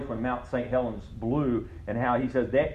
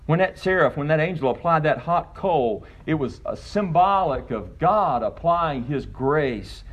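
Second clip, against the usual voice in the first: preaching at full force; 3 semitones higher than usual. Acted. Pastor to congregation on works and grace, preaching at full force. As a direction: preach it hard, pitched high over the congregation.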